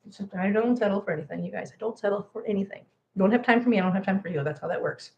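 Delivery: usual speaking voice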